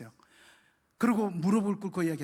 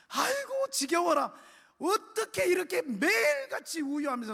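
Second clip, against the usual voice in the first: much higher voice for a weary neighbor woman; fed-up older woman; 9 semitones higher than usual. Retold or imitated. Deaconess bursting through the door, weary fed-up voice. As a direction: sigh it out, fed up with the daily crying.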